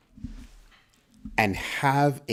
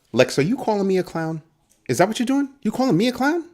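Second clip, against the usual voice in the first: mock confrontation; 13 semitones higher, 3 dB louder, faster than usual. Mock confrontation with chat member.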